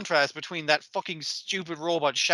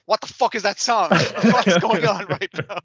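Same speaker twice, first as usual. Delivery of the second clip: baffled laughing outburst; 4 semitones higher, 5 dB louder than usual. Retold or imitated. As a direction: laugh it out in confusion. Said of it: "Sammy goes like".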